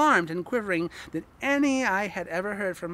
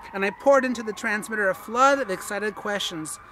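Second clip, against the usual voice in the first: ordinary delivery, nothing marked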